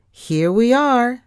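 loud, high, drawn-out call; yelling. A narrator's reading. Thea announces arrival at the ship, yelling. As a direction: yell the arrival, stretching it out. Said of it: "Thea yelled".

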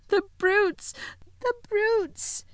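tearful Lucy. A narrator's reading. Lucy weeping at the cruelty. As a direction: plead through tears. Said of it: "tears streaming down her cheeks".

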